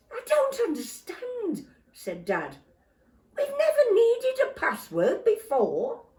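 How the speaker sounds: high, loud bewildered father; puzzled and flustered; baffled Dad. Acted.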